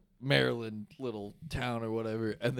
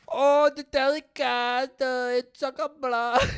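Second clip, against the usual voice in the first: high, slow and loud mock German accent; bad German accent; 11 semitones higher, 8 dB louder, slower than usual. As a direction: ham up a terrible German accent, drawn out. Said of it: "I'm not good at accents".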